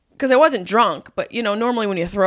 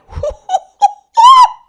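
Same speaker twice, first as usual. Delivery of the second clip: big high burst of laughter; loud laugh; 13 dB louder than usual. Real, not acted. Comic laughing at her own pie story. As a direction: cackle at the memory.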